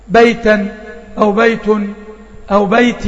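بيتًا بيت بيت each with a clear final n sound; teacher contrasting the three tanween endings; three tanween forms. Shown as a demonstration. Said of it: "أدي عندي تنوين بأشكاله الثلاثة".

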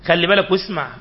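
usual speaking voice

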